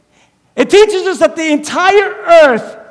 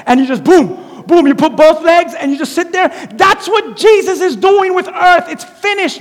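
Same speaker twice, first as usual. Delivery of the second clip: usual speaking voice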